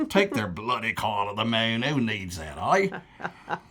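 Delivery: half-Cockney Australian accent mocking Yanks; a deliberately bad Australian accent, sneering at Americans. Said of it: "that was a very bad Australian accent, more Cockney".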